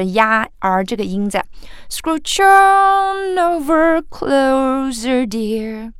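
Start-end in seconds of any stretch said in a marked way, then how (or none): 1.9-5.9 very slow, high pull on "Scrooge on"; exaggerated rising pull. Shown as a demonstration. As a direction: ham up the climb on "on". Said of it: "这样夸张的模仿". (夸张放慢往上拉)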